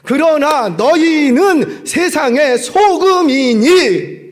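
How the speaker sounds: preacher declaring a Bible verse; solemn scripture quote. Acted. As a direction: proclaim the verse slowly.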